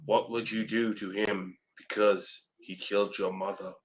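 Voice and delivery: ordinary talk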